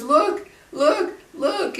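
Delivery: high, urgent, rising cries; imitated frightened son. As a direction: mimic a scared child urging parents on. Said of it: "he's shaking, just shaking".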